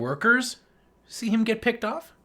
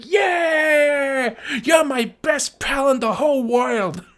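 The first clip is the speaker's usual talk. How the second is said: higher, louder joyful yell; gleeful mock shout; 6 semitones higher, 6 dB louder, much slower than usual. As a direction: shout praise to a new buddy. Cheering his pet monster.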